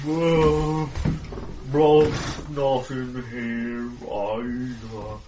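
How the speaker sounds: very slow, thick, dragged-out words; muttering slurred voice. Acted. GM voices a creature searching for Rolf, muttering slurred voice. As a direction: drawl it out like a slurring dimwit. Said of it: "a muttering, slurred voice talking away".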